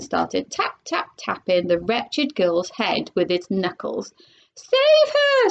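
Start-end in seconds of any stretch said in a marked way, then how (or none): 4.6-5.5 high, panicked mother's cry; frantic high scream without much extra volume. A narrator's reading. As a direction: scream for help in alarm, very high-pitched. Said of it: "screamed Missus Salt".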